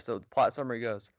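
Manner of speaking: ordinary talk, nothing marked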